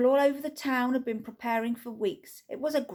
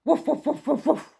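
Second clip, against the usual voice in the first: louder, higher mock woofs; dog barking; 5 semitones higher, 5 dB louder than usual. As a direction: bark like a playful dog.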